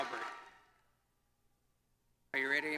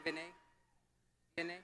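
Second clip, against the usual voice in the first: ordinary delivery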